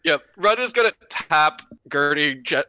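normal talking voice

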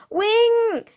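high, loud, drawn-out cry; screaming the name. A narrator's reading. Brother to the falling young raven, screamed call. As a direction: cry out in alarm from afar. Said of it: "hörte ich meinen Bruder schreien".

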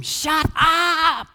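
loud, high shouted scolding; stern rebuke. A narrator's reading. Crowd rebukes the blind beggar, sternly shouting. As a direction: shout him down. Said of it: "lots of people sternly rebuked him".

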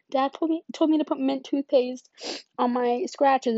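put-on high whiny child pleading for relief; pleading kid. A hurting child begging mom to let her wash it off, high and whiny.

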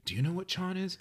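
put-on boy asking his friends, high and puzzled; baffled boy asking around. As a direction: turn to the other boys, pitched high and confused. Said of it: "and he goes".

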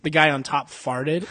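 normal talking voice

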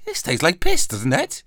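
put-on high, loud Welsh lady's accent. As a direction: put on a boozy Welsh accent for the wedding guest. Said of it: "that was a Welsh accent".

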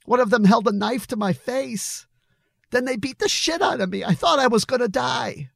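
very high, agitated yelling. A narrator's reading. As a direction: pour it out in a high, upset yell. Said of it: "Billy was yelling now".

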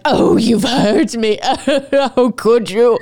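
느리고 높은 과장된 상처받은 목소리; mock wounded. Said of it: "And he's like".

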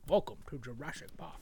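low Scottish-tinged Hammond line; Sean Connery impression. Friends quoting the movie greeting, mock Scottish. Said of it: "Why is Sean Connery in Jurassic Park?".

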